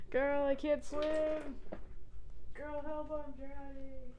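high mock cry for help; drowning. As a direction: pretend to be drowning.

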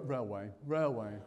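downbeat, level-pitched delivery; glum, everyday railway voice. Shown as a demonstration. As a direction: say it with no enthusiasm at all. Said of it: "say railway in a downbeat voice".

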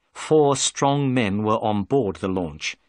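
usual speaking voice

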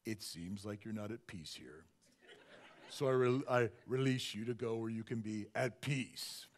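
low, slow, measured pastor voice; Chuck Smith. Acted. Pastor dismissing an unhappy critic, low serene impression. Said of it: "when you're Chuck Smith".

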